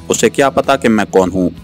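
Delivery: usual speaking voice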